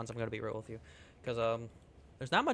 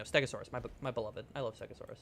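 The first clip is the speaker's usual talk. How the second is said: normal talking voice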